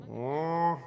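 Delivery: long imitated moo; drawn-out moo, like a cow. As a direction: try out a cow sound. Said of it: "Do I sound like a cow?".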